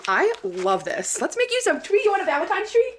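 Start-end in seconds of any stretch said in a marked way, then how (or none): 1.2-2.9 high cooing voice to the dog; pet talk. Pretend. Offering her dog a treat, high cooing pet talk. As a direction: coo at the dog like a baby.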